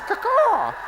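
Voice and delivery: high, squawked bird cry; falcon caw. Retold or imitated. Sports host mimicking the Falcons defense, falcon caw. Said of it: "make that sound all the time".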